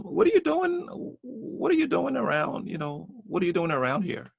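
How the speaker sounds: high mock cop, pitch falling toward the end; cop questioning. Cop asking the homeowner what he does there, high imitated voice. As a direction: imitate the cop's nosy question, starting high. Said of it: "He said".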